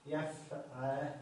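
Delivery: يفع- drawn out very slowly to prompt the class. Shown as a demonstration. Teacher prompting students to complete the pattern, slowly. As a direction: stretch يفع- and wait for students to finish. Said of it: "والمضارع يكون فيه إيش؟".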